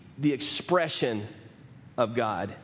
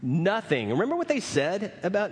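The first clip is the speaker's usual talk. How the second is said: usual speaking voice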